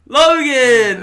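loud, high call of a name. Real, not acted. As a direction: announce him like a big entrance. Introducing guest, calling out.

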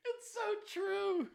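real high-pitched, tearful laughing fit; helpless laughter. Real, not acted. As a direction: squeal the words out through uncontrollable laughter. Helpless laughter at own joke.

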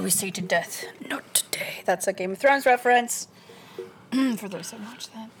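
unvoiced hushed whisper. Real, not acted. Whispered Game of Thrones quote.

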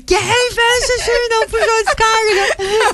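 high, slow yelling across the house; retold yell. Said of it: "E eu gritei".

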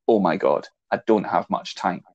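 ordinary talk, nothing marked

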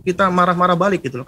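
ordinary delivery

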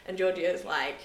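ordinary talk

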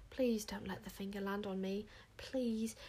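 soft, unvoiced desperate plea; frightened whisper. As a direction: whisper it, terrified. Wish's terrified inner plea.

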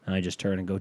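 usual speaking voice

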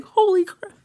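high, loud amazed exclamation; retold astonishment. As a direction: blurt it out in mock shock. Retelling astonished reaction to voice.

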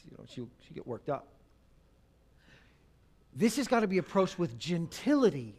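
ordinary talk

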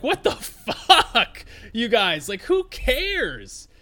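drawn-out, high-pitched disbelief through laughter; laughing, retold outburst of bafflement; mock exasperation. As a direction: stretch it out, appalled and amused. Retold outrage at friends in Hawaii.

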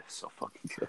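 breathless whisper of awe. Real, not acted. Fan gushing to friends about Bad Boys Two, hushed whisper. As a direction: whisper it like a reverent secret.

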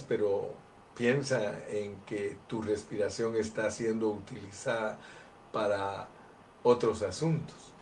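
usual speaking voice

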